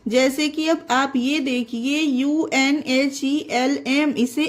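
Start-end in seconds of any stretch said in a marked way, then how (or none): none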